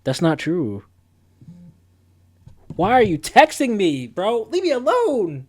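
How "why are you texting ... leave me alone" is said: exasperated, pushing the texter away; whiny leave-me-alone; annoyed. Real, not acted. Streamer annoyed at coworkers texting him, exasperated whine.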